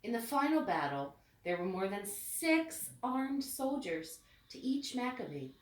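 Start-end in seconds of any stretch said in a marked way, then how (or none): none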